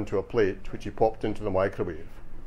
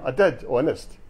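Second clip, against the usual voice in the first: usual speaking voice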